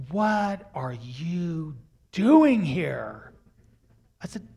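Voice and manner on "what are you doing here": 1 stern father. Retold or imitated. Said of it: "he looks at me and goes".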